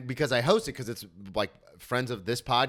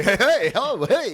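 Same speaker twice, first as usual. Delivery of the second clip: higher, louder overeager greeting; eager crowd work; 9 semitones higher, 9 dB louder, slower than usual. Retold or imitated. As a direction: beg the crowd for attention. Trying to win crowd over.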